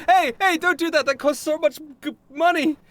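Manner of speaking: high-pitched panicked plea; alarmed protest. Acted. Staffer begs customer not to damage the costly PA, alarmed. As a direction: protest in a squeaky, frantic character voice.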